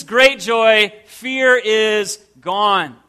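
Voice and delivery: normal talking voice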